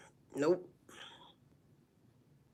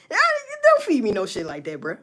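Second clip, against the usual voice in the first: ordinary talk, nothing marked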